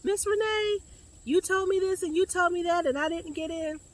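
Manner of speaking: high-pitched mock student whine; complaining student. Counselor imitating students who didn't get in, mock complaining. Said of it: "So don't be talking about".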